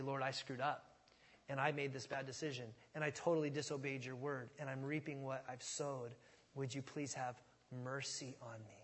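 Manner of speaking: soft, low prayer for mercy; hushed, contrite plea; humble confession. Acted. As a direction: own up quietly, voice sinking. Voices a humble confession to God.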